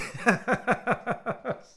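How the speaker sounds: high, loud run of giggles; real giggling at own silliness; laugh. Real, not acted. Laughs at the hat's price.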